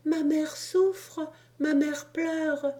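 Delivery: put-on high, slow, tearful child's lament; a boy aching for his crying mother; tearful child plea. Child insists his mother suffers.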